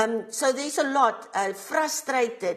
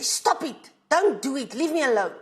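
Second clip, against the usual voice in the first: irritated, higher-pitched brush-off; 3 semitones higher than usual. Shown as a demonstration. Teacher demonstrates talking to someone who won't leave her alone, irritated. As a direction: say it irritated, fed up with being pestered. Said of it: "I'm using an irritated tone".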